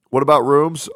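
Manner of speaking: ordinary talk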